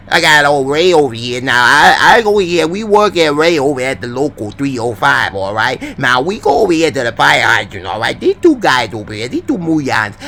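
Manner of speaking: put-on tough-guy Boston accent. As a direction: play a Southie local talking tough. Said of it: "How was my Boston accent?".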